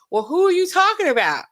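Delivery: 1 mock jealous woman. Imagining a jealous contestant.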